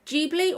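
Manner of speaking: "Ghibli" pronounced JEE-blee. Shown as a demonstration. Vlogger unsure of the studio's name, trying Ghibli as JEE-blee. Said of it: "I've never heard it pronounced".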